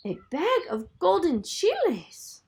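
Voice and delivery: high, stammering; scared boy bargaining; frightened stammer. Acted. Paco offering the chiles to the giant, frightened.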